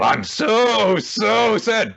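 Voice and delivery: hammed-up, drawn-out high wail of fake sadness; mock sorrow. Host voices a villain pretending to mourn his friend, mock sorrow. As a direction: stretch each "so" high and loud in mock grief. Voicing villain's sarcastic grief.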